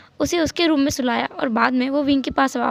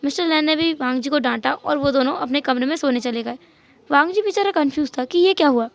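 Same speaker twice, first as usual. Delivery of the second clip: usual speaking voice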